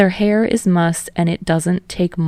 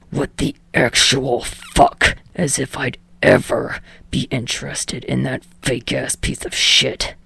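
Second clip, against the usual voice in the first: grind out each word in disgust; furious Bakugo; 4 semitones lower than usual. A narrator's reading.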